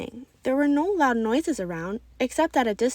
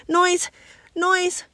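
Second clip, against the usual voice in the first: high, loud panicked scream; 9 semitones higher, 5 dB louder than usual. Acted. Boy overwhelmed by playground sound screams out loud in distress. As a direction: shriek it with eyes squeezed shut. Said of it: "Lucas screamed".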